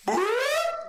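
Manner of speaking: very high short boop, like police lights flicking on; mock police light blip; sudden siren blip. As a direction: squeak out a siren chirp. Imitating the cop lights coming on.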